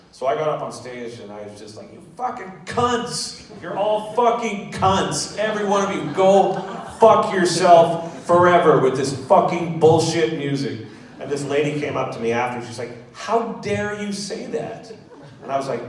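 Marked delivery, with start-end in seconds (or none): 1.9-10.9 high, slow, furious yell; screaming at crowd. Retold or imitated. 13.1-14.9 offended lady. Retold or imitated.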